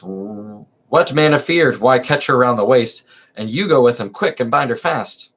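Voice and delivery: lively theatrical reading of a verse comedy; dramatic play reading. Acted. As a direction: read the chorus lines with stage energy. Reads magistrate.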